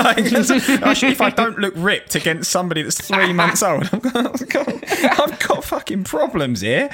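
high-pitched, laughing through the words; laughing protest. Real, not acted. Joking about being compared with a baby, laughing indignantly. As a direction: protest while cracking up.

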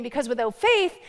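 ordinary talk, nothing marked

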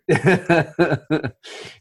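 big hearty laugh; loud laugh. Real, not acted. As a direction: burst out laughing. Laughing at Superman joke.

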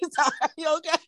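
high, helpless laughter breaking up the words; real laughing. Real, not acted. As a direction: squeak out an apology between laughs. Still cracking up while apologizing.

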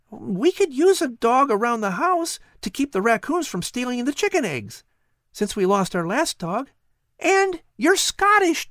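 put-on high, eager boy voice; a curious boy talking to a cat; young boy Patrick. Boy Patrick wondering aloud to the cat, high and eager.

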